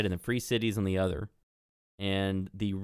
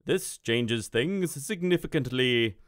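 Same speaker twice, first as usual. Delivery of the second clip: slow, weighty wizard, raised from the narrator's voice; wizard Mun; 4 semitones higher, slower than usual. Acted. Wizard reacts to news of the broken river, slow pronouncement. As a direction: voice a grave pronouncement, stretched out. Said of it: "And he says".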